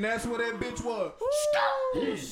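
high, loud shouting through laughter; laughing shout. Real, not acted. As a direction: yell between fits of laughter. Shouting through laughter recalling spot.

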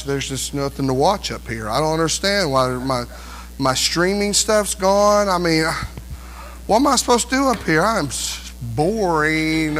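a sulky heaven-goer, lightly voiced; mock bored whine. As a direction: moan like nothing's on TV.

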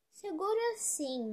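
lightly voiced, slow and lifted yes; obliging manatee. A narrator's reading.

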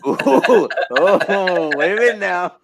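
high, loud laughing exclamations. Real, not acted. Laughing at the host's joke about picking his wife. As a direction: laugh it off, delighted and flustered.

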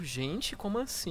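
soft rising disbelief; baffled. Retold or imitated. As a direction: ask it half under breath, puzzled. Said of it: "eu fico".

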